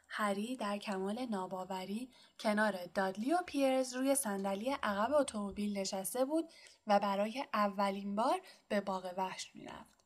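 usual speaking voice

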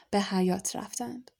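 ordinary delivery, nothing marked

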